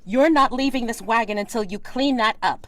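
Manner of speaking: high, loud furious scold; angry scolding. Acted. Lady scolding her hired man over the mess, high and loud. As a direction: lay down the law, furious.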